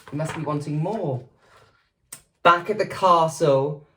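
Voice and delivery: ordinary delivery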